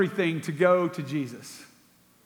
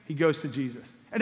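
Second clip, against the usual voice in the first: usual speaking voice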